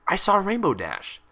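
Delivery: usual speaking voice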